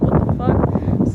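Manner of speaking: slow, drawn-out whispered what the fuck; retold disbelief, whispered and slow; stunned disbelief. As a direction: let the shock stretch out each word. Shocked by the same cop again.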